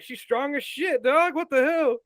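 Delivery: high, drawn-out imitated amazement; slow, high-voiced acted incredulity; mock disbelief. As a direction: play stunned, voice pitched right up. Acts out Wanda's stunned reaction.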